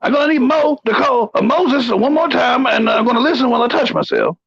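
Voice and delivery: put-on high, blustering old colonel; pompous sputtering military officer. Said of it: "do every top ten in the Colonel voice".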